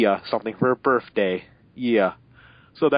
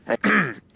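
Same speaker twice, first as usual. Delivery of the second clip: throat clear; 10 semitones higher than usual. Real, not acted. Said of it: "Excuse me, had to take a little break there".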